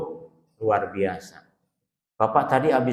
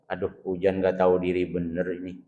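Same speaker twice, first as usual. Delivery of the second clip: low, soft, sulky complaint about the rain; muttered grumble; 8 semitones lower, 11 dB quieter than usual. Retold or imitated. Host mutters to himself when rain spoils the event, sulky grumble. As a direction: grumble it under your breath.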